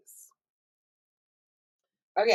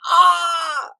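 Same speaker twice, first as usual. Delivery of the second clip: sudden high shriek of a spoiled viewer; mock scream; 11 semitones higher, 9 dB louder than usual. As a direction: let out a shocked, hammed-up scream. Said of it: "And I'm like".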